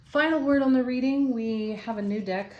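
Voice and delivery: spring into the announcement; excited. Real, not acted. Reader eagerly announcing the final word on the reading.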